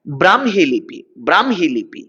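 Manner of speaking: "ব্রাহ্মী" as bram-hi, the h sounded after m; correct pronunciation. Shown as a demonstration. Teacher shows students how the word goes, correctly pronounced. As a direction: model "ব্রাহ্মী" clearly, bram then hi. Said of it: "উচ্চারণে ম হ".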